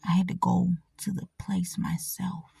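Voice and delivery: a soft whisper, almost to herself. Real, not acted. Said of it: "repeated in normal voice".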